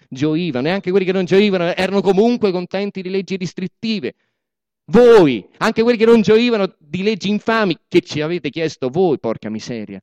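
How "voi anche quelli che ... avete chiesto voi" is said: a loud, high outburst on the first Voi, then a driving accusation; angry accusation. Acted. Boss accusing the proletariat, loud and angry. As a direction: point the finger and hurl the blame.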